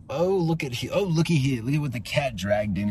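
high put-on slimy crony voice. Slimy crony sneers at the repo man, in a high put-on voice. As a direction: ooze it out like a sleazy sidekick.

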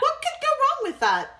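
mock-innocent high question; sarcastic. Real, not acted. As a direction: play dumb, high and ironic. Sarcastic remark about Feyre.

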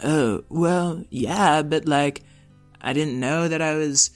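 put-on high, lively wise old robot; old rusty robot. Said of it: "I'm a wise old robot".

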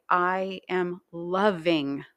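slow, with "loving" drawn out; emphasize "loving" and stretch it; stress on LOVING. Shown as a demonstration. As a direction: lean heavily on "loving". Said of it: "you're emphasizing each word each time".

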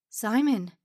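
loud, stunned recognition; name said in shock. A narrator's reading. Mr Stern shocked at his return.

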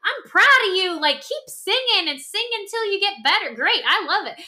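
high, fast, gushing cheer; cheering supporter. Retold or imitated. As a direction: hype her up like a proud friend. Voicing a supportive cheering fan.

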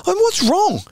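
very high, strained question; acted exasperation. Player up close to yelling teammate, exasperated.

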